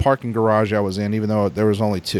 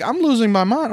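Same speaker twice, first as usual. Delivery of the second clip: normal talking voice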